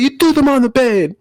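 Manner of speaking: high imitated accusation; high girlfriend accusing voice; mock girlfriend. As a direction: pitch up as her, accusing. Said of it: "She's like".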